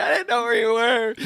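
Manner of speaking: put-on a boy close to tears; upset little kid. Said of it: "I was like".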